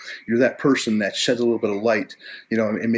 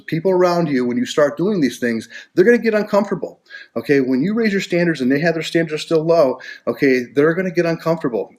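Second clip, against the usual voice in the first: raised warning; 6 semitones higher, 5 dB louder than usual. Real, not acted. Warning listeners about others' reactions.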